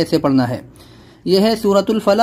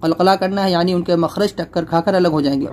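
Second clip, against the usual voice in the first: ordinary talk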